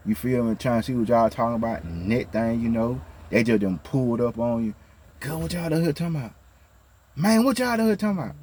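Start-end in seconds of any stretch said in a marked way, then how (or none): 5.2-8.4 high, fast nosy busybody voice; nosy coworker. Retold or imitated. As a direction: mimic a nosy coworker butting in. Nosy coworkers pulling up, acted.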